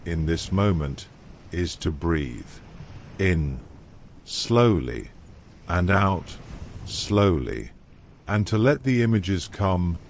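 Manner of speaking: usual speaking voice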